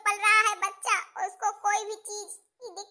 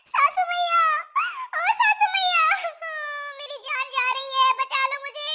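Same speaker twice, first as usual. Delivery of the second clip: high, louder wailing for the mother-in-law; labour cries; 3 semitones higher, 4 dB louder than usual. Acted. Daughter-in-law in labour calls her mother-in-law, wailing. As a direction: cry out in pain, begging.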